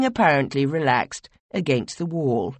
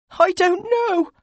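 much higher, louder, slow cry; 5 dB louder, slower than usual. Acted. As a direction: cry out in distress. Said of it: "you cried".

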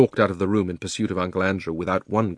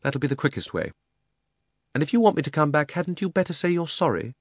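cold; 7 semitones higher than usual. A narrator's reading. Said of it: "rather coldly".

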